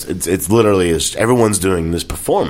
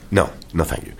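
retold polite refusal; 2 semitones lower, 6 dB quieter than usual. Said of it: "And he goes".

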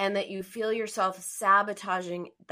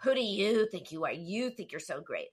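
harsh inner voice; 3 semitones lower than usual. Retold or imitated. Coach imitating client's inner critic, low and scornful. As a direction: drop from delight into a cutting mock. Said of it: "it was still really harsh".

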